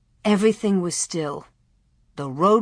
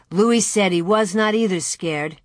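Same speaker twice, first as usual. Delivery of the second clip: plain reading voice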